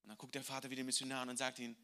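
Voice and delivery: ordinary talk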